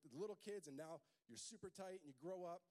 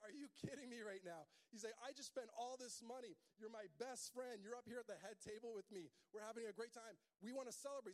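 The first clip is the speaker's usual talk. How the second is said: high, put-out friend at the table; indignant buddy; 7 semitones higher than usual. Acted. Groom to friend refusing food, high and indignant. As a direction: play the best friend, baffled and hurt. Buddy voiced in wedding story.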